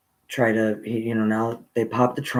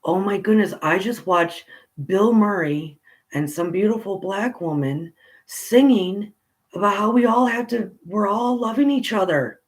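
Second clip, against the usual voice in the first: put-on higher, bubbling childlike wonder; wide-eyed little kid; 8 semitones higher than usual. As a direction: voice your younger self, dazzled and earnest. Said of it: "I am a little kid going".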